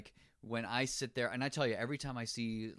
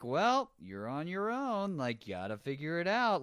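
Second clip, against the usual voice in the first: offhand brush-off; 6 semitones higher, 3 dB louder than usual. Retold or imitated. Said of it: "he's also like".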